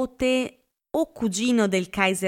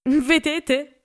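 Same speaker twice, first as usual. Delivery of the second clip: high, loud, gloating cry; triumphant exclaim; 8 semitones higher, 5 dB louder than usual. A narrator's reading. Catherine crowing to the guests, triumphant and loud. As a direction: crow over being proven right. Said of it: "esclamò Catherine, trionfante".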